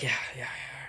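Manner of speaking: imitating him, hushed serious whisper; subdued, sober agreement under the breath; all serious. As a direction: whisper it low and grave. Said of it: "Like, all serious".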